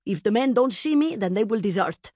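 stern mother's firm tone. A narrator's reading. Mother in armour to pleading daughter, stern. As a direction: lay it down sternly. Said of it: "she said sternly".

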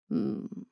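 breathy low hum; soft considering hum. A narrator's reading. Owen hums, not quite believing her. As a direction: hum softly, unconvinced.

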